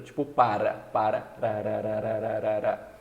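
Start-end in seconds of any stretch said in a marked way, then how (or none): 1.4-2.9 ra rattled off as repeated tongue taps; Brazilian tapped R. Shown as a demonstration. Teacher shows the R in para, tapped ra repeated. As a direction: flick the tongue through a chain of ra. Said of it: "som do R brasileiro".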